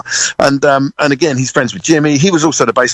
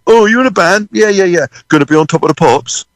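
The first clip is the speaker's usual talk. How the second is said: higher-pitched imitation of an impressed stranger; interested small-talker; 6 semitones higher than usual. Anyone in the UK quizzing young drummer, lightly mimicked. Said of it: "conversation goes with everyone in the U K".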